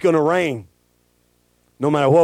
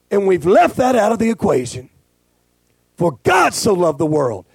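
usual speaking voice

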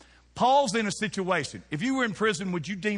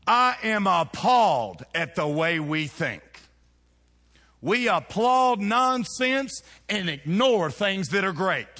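loud slow outrage; 3 semitones higher, 6 dB louder, slower than usual. Real, not acted. Preacher declares outrage.

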